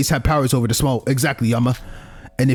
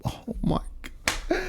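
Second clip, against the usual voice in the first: exasperated whisper; 8 semitones higher, 12 dB quieter, much slower than usual. Real, not acted. Streamer groans at being dragged off.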